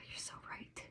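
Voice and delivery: soft whispered oh, you're so right; whisper, conceding to the dog; whispered agreement. Retold or imitated. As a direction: owner sheepishly admits the dog is right. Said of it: "makes me look at her and go".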